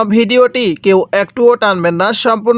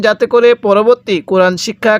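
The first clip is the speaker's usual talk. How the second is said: ordinary talk, nothing marked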